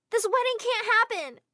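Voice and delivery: high, loud and slow protest; desperate scream. Acted. Girl screams at the guests to halt the wedding. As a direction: scream it at the whole room. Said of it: "I screamed when I entered".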